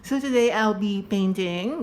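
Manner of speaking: usual speaking voice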